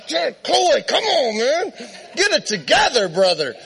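high-pitched mock exasperation; exasperated plea. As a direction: plead with an embarrassing friend. Embarrassed young man scolds his friend.

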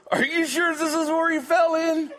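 high, loud, laughing punchline; the drunk gasping up from the water, high and loud; sputtering drunk. Acted. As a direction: blurt it out through laughter. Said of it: "Drunken goes".

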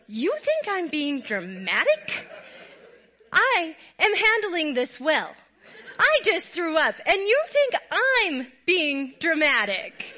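loud, slow, laughing indignation; mock indignant retort. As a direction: hammer each phrase like a hurt wife, laughing. Wife retorts in heated argument.

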